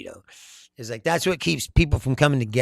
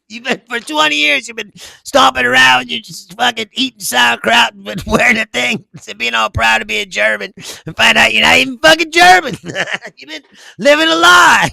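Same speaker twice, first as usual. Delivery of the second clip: loud, high, incredulous scolding broken by laughs; mock outraged rant; 6 semitones higher, 5 dB louder than usual. Comedian telling off the fake German from the ad, mock outrage. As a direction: berate him, building to an amused shout.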